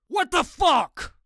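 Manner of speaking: a huge high shout, slow and stretched out; enraged roar. A narrator's reading. The struck football player roaring at the narrator, an enraged shout. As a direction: roar it like a man just hit in the face. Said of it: "a deep voice roared".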